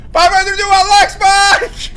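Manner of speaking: loud, high-pitched excited shout; ecstatic yelling. Real, not acted. Streamer celebrating to viewers after landing, ecstatic yell.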